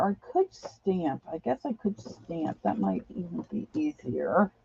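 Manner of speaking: usual speaking voice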